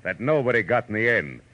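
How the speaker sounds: teaser line. Acted. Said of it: "when Philip Marlowe says".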